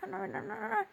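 ordinary delivery

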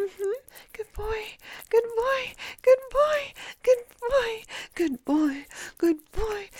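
high, loud, breathless urging; urgent, high-pitched encouragement; mounting excitement. Acted. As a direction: build to a high peak, then ease off. Acted climax of adult intimate roleplay.

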